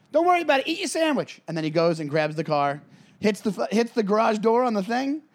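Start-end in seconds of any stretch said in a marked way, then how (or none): 0.1-1.4 lightly voiced, higher cocky brush-off; cocky badass. Riggs waving off the mechanic, a light high-pitched cocky badass. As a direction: toss it off like an unbothered action hero. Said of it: "crazy Martin Riggs".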